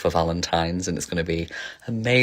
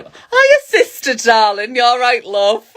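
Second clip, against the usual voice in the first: high, loud, sing-song greeting; camp runway greeting; 7 dB louder, slower than usual. Pretend. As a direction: camp it up like you're strutting onto the runway. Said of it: "But I was gonna say".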